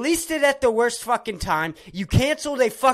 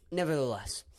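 ordinary talk

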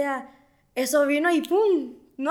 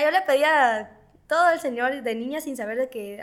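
ordinary talk, nothing marked

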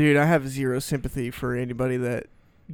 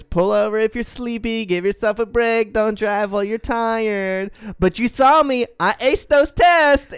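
mock drunk girl; 5 semitones higher, 3 dB louder than usual. Drunk girl bragging to the cop, mock ditzy voice. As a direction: ham up a drunk driver proud of her test. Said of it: "she goes".

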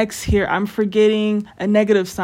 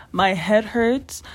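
ordinary talk

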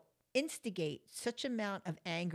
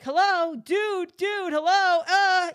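loud, much higher calling out; shouting for attention; 11 semitones higher, 15 dB louder, slower than usual. Retold or imitated. Calling after the man who ignores her, loud shouting. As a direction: yell to make him turn around. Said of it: "I'm like".

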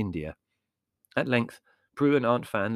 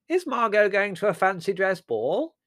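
high, loud, innocent query; interested dotty aunt; 12 semitones higher, 6 dB louder than usual. A narrator's reading. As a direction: pipe up with oblivious curiosity. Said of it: "watched the procedure with interest".

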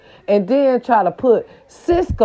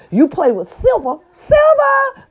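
mocking silver; 4 dB louder than usual.

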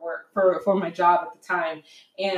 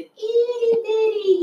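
high, drawn-out little voice; itty-bitty baby-talk pitch; tiny cutesy squeak; slower than usual. Acted. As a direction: stretch it out high, mocking tininess. Describes problem as tiny.

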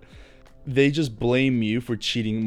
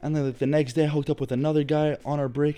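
put-on higher ex girlfriend voice; ex girlfriend impression; 5 semitones higher than usual. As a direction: voice the ex, pitched up. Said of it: "she's like".

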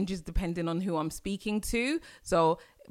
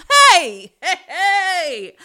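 delighted laughing "hey, hey"; joyful laughing cheer; excited whoop; 11 semitones higher, 12 dB louder, much slower than usual. Real, not acted. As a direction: squeal with glee.

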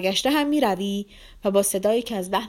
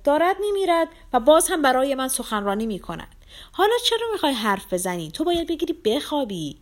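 higher, louder indignant scolding; horrified exasperation; 7 semitones higher, 5 dB louder than usual. A narrator's reading. Lizaveta watches the dying man in horror, angry scolding. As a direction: scold him hotly, voice raised. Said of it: "وحشت زده تماشا کنان با غیظ گفت".

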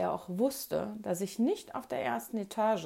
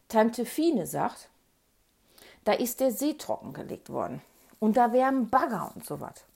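ordinary delivery

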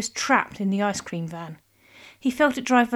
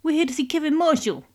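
put-on higher, heavy New Jersey accent; 7 semitones higher, 3 dB louder than usual. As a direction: tough Jersey mobster talk. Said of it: "thick New Jersey accents".

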